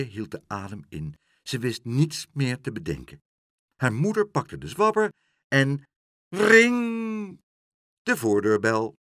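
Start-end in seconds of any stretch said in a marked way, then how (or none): 6.3-7.4 high, loud, drawn-out ring; sustained high-pitched bell noise; doorbell imitation. A narrator's reading.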